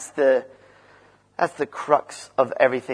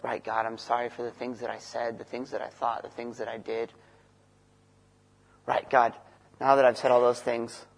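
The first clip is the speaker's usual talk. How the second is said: much softer, a murmured confession to God; hushed mock prayer; 5 dB quieter than usual. Preacher voicing his own prayer to God, much softer. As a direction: pray it quietly, half to yourself. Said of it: "I always come into prayer".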